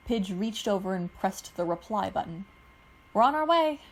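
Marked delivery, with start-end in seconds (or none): 3.1-3.8 calling back. A narrator's reading. Answering over the intercom.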